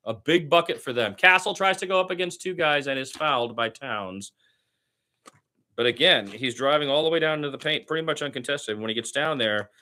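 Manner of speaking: lively radio play-by-play call of a Spurs game. Acted. Fan practicing radio play-by-play.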